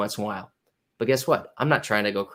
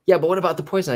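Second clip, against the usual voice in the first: high, louder worried whine; higher, louder mock-anxious objection; whiny client; 7 semitones higher, 4 dB louder, faster than usual. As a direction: play the fretful client who can't let go.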